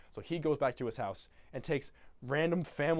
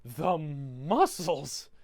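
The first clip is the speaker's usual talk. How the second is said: very slow dreamy sigh; mock swoon; 3 dB louder, much slower than usual. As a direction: melt over it slowly. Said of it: "Cindy Lou Who goes".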